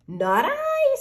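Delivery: very high, honking goose. Acted. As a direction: flutter it out like a diva goose. Goose refuses.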